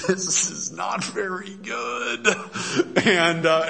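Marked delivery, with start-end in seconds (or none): 0.1-2.3 drawn-out, higher mock lament; imitating disappointed elders, pitched up and stretched; distressed onlookers. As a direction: voice their dismay in a long, slow whine. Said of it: "and they're distressed".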